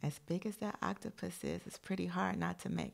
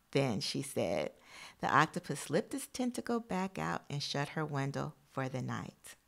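lively read-aloud storytelling, louder than her chat; storybook voice for young listeners; reading to children; 5 dB louder than usual. Acted. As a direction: read the picture book out to the class. Reads picture book to class.

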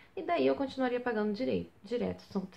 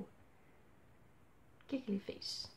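whisper; 12 dB quieter, much slower than usual. Real, not acted. Dramatic question in the story.

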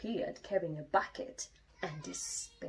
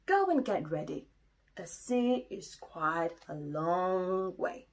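Daddy Bear. Acted. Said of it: "said Daddy".

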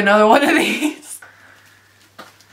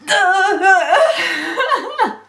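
pained laugh, high; 8 semitones higher than usual. Real, not acted. Ripping latex off fast, a high pained laugh. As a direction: laugh high through the sting.

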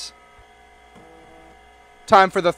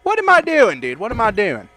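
ordinary delivery

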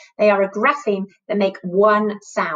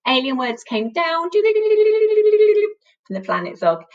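spooky alien voice; 10 semitones higher, slower than usual. Shown as a demonstration. Alien planet story voice.

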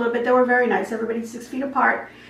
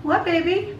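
higher, tender pet voice; cooing to the cat; 6 semitones higher than usual. Real, not acted.

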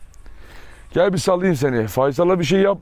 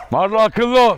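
raised, higher warning call; sterner warning; 4 semitones higher, 3 dB louder than usual. Real, not acted. Owner warning Marla to behave, raised stern voice. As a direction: call the dog to order.

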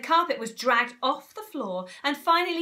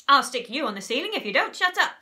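fast, loud irritated snap; snapped threat; 3 semitones higher, 7 dB louder, faster than usual. A narrator's reading. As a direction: silence the little monkey. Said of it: "snapped Mugglewump".

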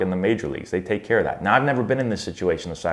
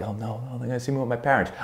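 hushed, slightly raised mock dread; embarrassed kid; 4 semitones higher, 5 dB quieter, faster than usual. Kid spotted by friends at the mall with parents, mock embarrassed. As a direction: lightly act out a kid's embarrassment, softly. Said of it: "the embarrassed kid".

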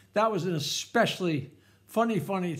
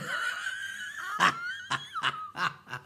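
very high, softer cackle; laughing; 6 dB quieter than usual. Real, not acted. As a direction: cackle at your own joke. Laughs at own punchline.

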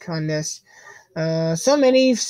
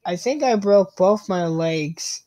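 normal talking voice

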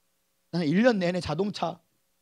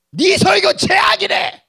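higher, louder, slow taunt; mocking aunt; 11 semitones higher, 8 dB louder, slower than usual. Aunt taunting nephew that his sermons are the worst, slow and loud. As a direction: drag out the insult to wound. Aunt quoting bad review.

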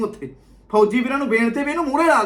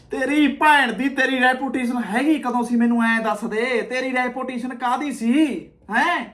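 ordinary talk, nothing marked

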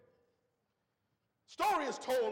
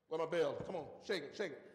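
lower, urgent call into the pit; calling down the rope; 8 semitones lower, 4 dB quieter, faster than usual. Retold or imitated. Men at the rope calling to the priest, lower and urgent. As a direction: tug and call for the bell.

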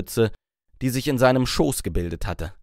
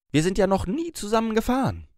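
higher, breezy small-talk voice; light chatty opener, pitched up; 10 semitones higher than usual. Acted. Mila making small talk with the driver, higher and breezy. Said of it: "in bestem Smalltalk-Ton".

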